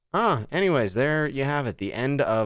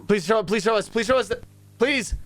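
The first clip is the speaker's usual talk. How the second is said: high, pleading whine of please; begging like a kid for a treat; mock begging; 11 semitones higher than usual. Streamer begs the game to show the frame, whiny plea.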